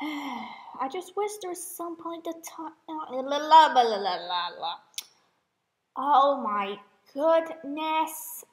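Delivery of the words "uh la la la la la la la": rapid la-la-la babble, falling in pitch; tongue-loosening babble. Real, not acted. Speaker after botching a sentence, babbling la-las. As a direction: babble nonsense la-las sliding downward.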